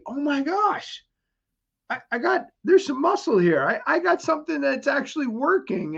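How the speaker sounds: high excited voice; amazed at new muscle; excited kid. Retold or imitated. Kids seeing muscle, excited.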